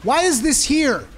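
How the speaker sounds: high, loud outraged shouting; real gamer rage; frustrated yelling. Real, not acted. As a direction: yell in disbelief at the healer. Raging at a bad healer.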